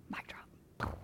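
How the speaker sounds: breathy aside, whispered; whispered punchline. Real, not acted. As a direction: drop it in a whisper like a secret joke. Punchline after Jesus' retort.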